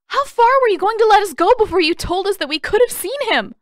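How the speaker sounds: fast, high, loud furious outburst; enraged yell. Acted. Katara screams at Aang over their father, fast and enraged. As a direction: let the rage pour out fast. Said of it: "Hot, enraged tears".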